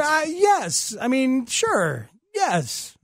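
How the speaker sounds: a reluctant yes that rises in pitch; voice going up. Real, not acted. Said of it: "Your voice went up there".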